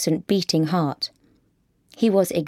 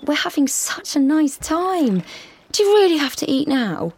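put-on pleading girlfriend; 8 semitones higher than usual. A narrator's reading.

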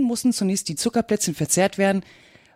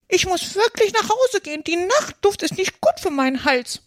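very high, put-on bird voice; fussy old magpie; 12 semitones higher than usual. A narrator's reading. Old magpie excuses herself to go home, high-pitched. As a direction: make a prim excuse in a very high voice. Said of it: "Eine alte Elster fing an".

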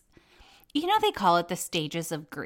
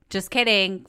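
usual speaking voice

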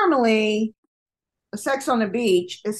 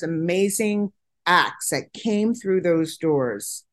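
usual speaking voice

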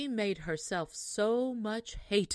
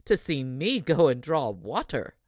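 put-on louder, sneering rude girl; ill-bred minx; 4 semitones lower, 8 dB louder than usual. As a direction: scoff at the idea of fetching water. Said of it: "said this ill-bred minx".

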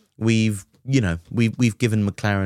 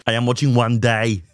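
put-on voice of a team boss; Toto; 3 semitones higher, 4 dB louder than usual. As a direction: deliver it as a caricatured pit-wall interviewee. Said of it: "Toto on the pit wall".

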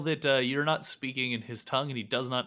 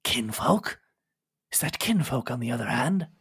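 lightly voiced lizard, questioning rise; a wary creature asking; hint of a lizard croak; 3 dB louder than usual. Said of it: "kind of coughs and says".

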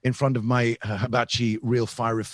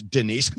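high, loud burst of laughter; shrieking laugh; 8 dB louder than usual. Acted. Bursts out laughing mid-story.

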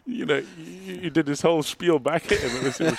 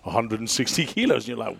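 ordinary talk, nothing marked

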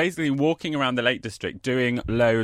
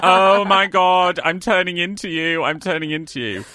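excited exclaiming; 7 semitones higher, 6 dB louder than usual. Retold or imitated. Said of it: "I'm like".

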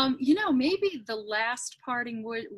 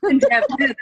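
burst of laughter; 10 dB louder than usual. Real, not acted. Laughing at made-up word.

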